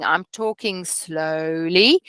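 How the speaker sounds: slow, deliberate demo pace; talking slowly. Shown as a demonstration. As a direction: show them how to slow right down, word by word. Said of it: "can you see how I'm talking".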